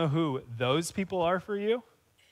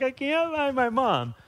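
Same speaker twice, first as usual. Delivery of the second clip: put-on lost crying toddler; 7 semitones higher, slower than usual. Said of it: "a two-year-old that's crying and lost".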